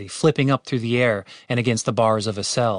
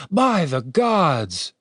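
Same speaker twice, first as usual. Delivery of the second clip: higher, very slow and drawn-out; blissful awe; 5 semitones higher, much slower than usual. A narrator's reading. Healed guard lying back, dazed bliss. Darren dazed by healing pleasure.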